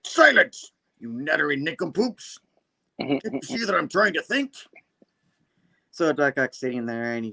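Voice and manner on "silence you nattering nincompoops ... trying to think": high, hammed-up supervillain scolding; imperious villain. Acted villain snapping at fans.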